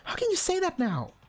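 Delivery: put-on high, accusing young girl, rising to a yell; shrill teen girl accusing everyone; high Hina voice. Hina upset.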